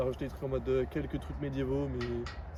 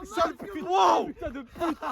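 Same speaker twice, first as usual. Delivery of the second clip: excited shouting; 13 semitones higher, 4 dB louder, slower than usual. Real, not acted. Caught by surprise by friend.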